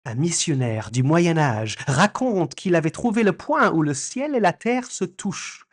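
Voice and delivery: put-on reading the caption in French accent; careful French pronunciation. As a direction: recite it like a native speaker.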